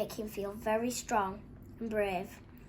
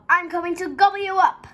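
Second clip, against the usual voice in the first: very loud, high troll threat; full-force menacing storybook roar; roaring even louder; 9 semitones higher, 17 dB louder than usual. A narrator's reading. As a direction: bellow it as a charging troll. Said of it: "roared the troll, even louder than before".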